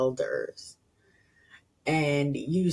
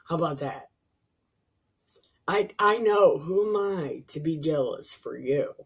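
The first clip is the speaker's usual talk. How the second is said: usual speaking voice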